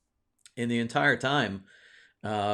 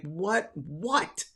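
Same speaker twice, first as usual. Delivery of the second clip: stunned what; 8 semitones higher than usual. Retold or imitated. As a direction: echo the bad news in disbelief. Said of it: "I'm like".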